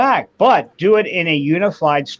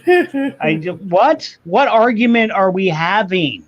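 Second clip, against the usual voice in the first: incredulous; 5 semitones higher, slower than usual. Retold or imitated. Incredulous retort.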